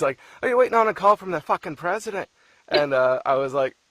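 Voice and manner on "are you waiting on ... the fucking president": imitating an angry husband, high and loud; exasperated mock outburst, pitch shooting up; mock pissed-off. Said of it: "he's fucking pissed".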